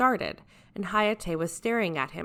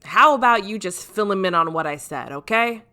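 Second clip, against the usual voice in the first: loud captain Jiraiya; 3 semitones higher, 10 dB louder than usual. Acted. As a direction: announce it like a brash captain taking charge. Jiraiya deflects after drinking.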